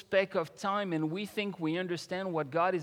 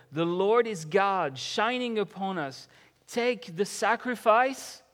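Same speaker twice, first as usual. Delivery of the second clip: loud, raised, fervent scripture declamation; impassioned psalm reading; 3 semitones higher, 7 dB louder than usual. Acted. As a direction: proclaim it as a plea and praise to God.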